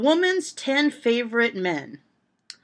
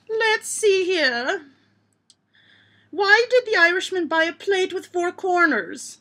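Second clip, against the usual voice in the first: hammed-up Irish accent, pitched high; jokey stage-Irish lilt, begorra and all; 11 semitones higher, 6 dB louder than usual. Vlogger reading jokes in a put-on Irish accent. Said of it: "I must be doing it in an Irish accent".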